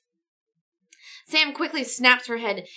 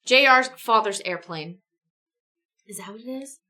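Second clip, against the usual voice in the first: ordinary talk, nothing marked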